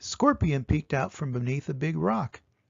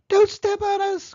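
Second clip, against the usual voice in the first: much higher, louder squeak; tiny ants crying; 8 dB louder than usual. A narrator's reading. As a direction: cry out in tiny voices. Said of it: "cried the ants".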